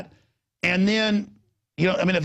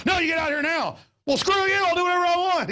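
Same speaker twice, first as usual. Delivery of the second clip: acted angry exchange; 12 semitones higher than usual. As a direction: fire back defiantly in the retelling. Acted quarrel at the event.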